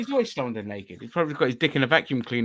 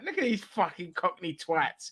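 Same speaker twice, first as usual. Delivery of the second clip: put-on Mancunian accent; 5 semitones higher, 7 dB quieter, slower than usual. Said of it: "they'd just be Mancunians".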